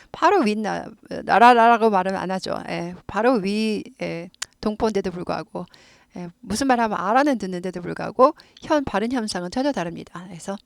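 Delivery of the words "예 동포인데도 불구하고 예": ordinary delivery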